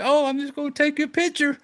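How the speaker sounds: put-on high, airy, oblivious girl; clueless girl voice. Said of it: "And she's just kinda like".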